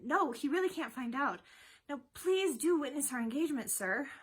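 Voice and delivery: high-pitched exasperation; exasperated. A narrator's reading. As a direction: plead loudly at the end of your patience. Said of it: "in exasperation".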